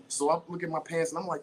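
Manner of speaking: ordinary delivery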